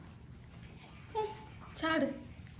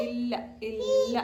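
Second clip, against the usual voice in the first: playful drawn-out denial; much slower than usual. Real, not acted. Mother telling her baby it's gone, playfully drawn out. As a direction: draw each word out for the little one.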